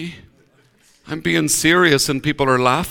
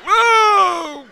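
mock surprise; 14 semitones higher than usual. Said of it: "And I".

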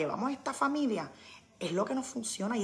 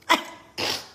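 breathy laugh; 4 dB louder than usual. Real, not acted. Laugh after joke.

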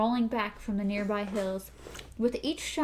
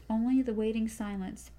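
ordinary delivery, nothing marked

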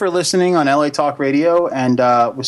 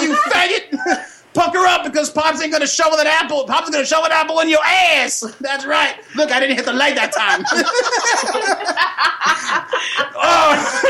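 higher cartoon puppet voice, rowdy and laughing; Pops puppet voice; 10 semitones higher than usual. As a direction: play a brash puppet roasting trick-or-treaters in a higher voice.

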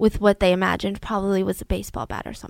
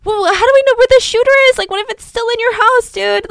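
scared confusion; 12 semitones higher, 8 dB louder, faster than usual. Retold or imitated. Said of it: "He's confused".

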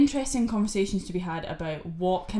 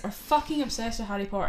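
ordinary delivery

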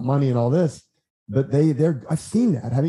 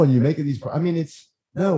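ordinary delivery

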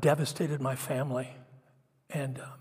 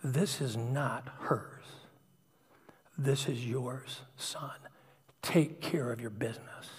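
slow, quiet, firm word from above; measured, lowered voice of God; God speaking; 3 semitones lower, 6 dB quieter, slower than usual. Retold or imitated. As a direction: speak slowly and softly, with weight. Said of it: "heard God almost literally say to me".

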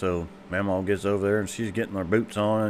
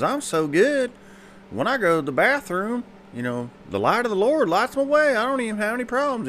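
put-on high old papa voice; 13 semitones higher than usual.

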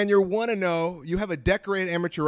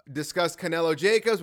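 ordinary delivery

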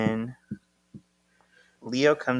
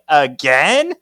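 high, loud incredulous question; acted outburst of dismay; exasperated disbelief; 5 semitones higher, 9 dB louder than usual. Leo hears they are arguing, mock exasperation.

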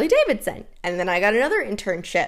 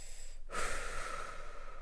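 long breathy exhale; slow release of breath; deep calming sigh; 16 dB quieter than usual. Real, not acted. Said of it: "take a breath with me".

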